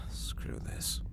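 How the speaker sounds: unvoiced frustrated whisper; captain muttering alone at teleporter. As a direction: whisper it to yourself, fed up. Mutters alone after leaving.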